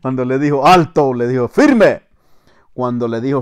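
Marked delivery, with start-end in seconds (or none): 0.7-1.1 sudden loud halt order; sergeant's command. Retold or imitated. Sergeant surprising the corporal, shouted command. 1.5-2.0 high, loud barked order; sergeant's command. Retold or imitated. Sergeant ordering the corporal to attention, shouted command.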